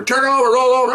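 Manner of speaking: high, urgent mock police commands; imitated yelling. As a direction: bark orders over someone's screams. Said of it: "they're yelling at her".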